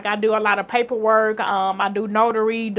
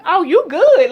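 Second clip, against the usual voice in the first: high, loud delighted reassurance; oh you're all set, beaming; excited; 8 semitones higher, 10 dB louder than usual. Retold or imitated. Social worker telling her she's fine, excited. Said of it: "she was like".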